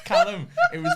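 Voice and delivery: tearful laughing; laughing to tears. Real, not acted. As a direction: squeeze the words out through laughter. Laughing so hard near tears.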